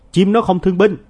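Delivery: put-on high, loud child's shout; yelling in his face. A narrator's reading. Nam yells at his classmate, a loud high shout. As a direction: scream it right in his face. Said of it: "thét vô mặt tôi".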